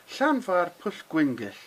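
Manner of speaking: "Llanfairpwll Gwyngyll" slowly, each part clear; slow Welsh demo. Shown as a demonstration. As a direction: carefully sound out "Llanfairpwll Gwyngyll". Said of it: "a little bit of a tongue twister".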